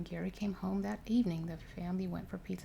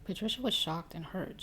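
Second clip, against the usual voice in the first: ordinary delivery, nothing marked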